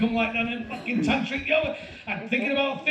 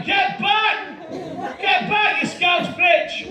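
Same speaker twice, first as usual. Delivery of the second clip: high, angry yell at a runaway dog; shouting; 7 semitones higher, much slower than usual. Acted. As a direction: yell the dog back, pitch way up. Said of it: "I'm shouting".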